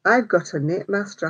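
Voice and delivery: ordinary talk, nothing marked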